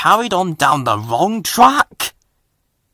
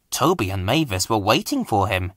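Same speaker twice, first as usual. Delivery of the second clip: ordinary delivery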